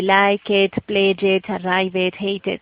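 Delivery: "like it, pledge it, arrive it, hate it" with -ed as an extra syllable; wrong spelled-out -ed. Shown as a demonstration. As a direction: demonstrate the mistake, each -ed sounded out as "ed". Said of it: "eso no se pronuncia así". (-ed mal pronunciada, como sílaba aparte)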